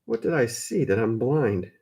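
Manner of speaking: ordinary delivery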